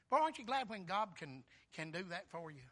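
ordinary talk, nothing marked

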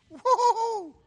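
loud, high "woo-hoo-hoo"; jubilant whoop. Real, not acted. Preacher rejoicing at Christ's return from death, jubilant whoop. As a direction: whoop it out joyfully.

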